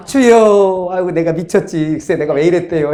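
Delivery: half-laughing show of regret; mock rueful lament. Believer repenting to the Lord as day returns, playful rueful lament. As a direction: lightly act out sudden remorse.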